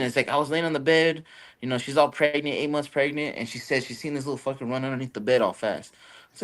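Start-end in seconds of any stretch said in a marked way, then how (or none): none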